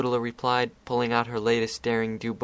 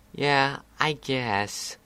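dejected; 3 semitones higher, much slower than usual. A narrator's reading. Dejected reluctant agreement.

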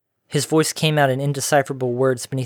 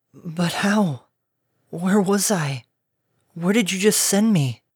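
numbed amazement; 6 semitones higher, slower than usual. A narrator's reading. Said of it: "numbed amazement".

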